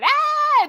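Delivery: a mother's furious yelp; high mock shriek; mock angry shriek. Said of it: "the mom going".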